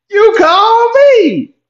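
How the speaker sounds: high stretched squeal of disbelief, slow; incredulous squeal. Retold or imitated. To the girl who called him, a slow high squeal of disbelief. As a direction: stretch it out high like you can't believe it. Mock outrage at her calling.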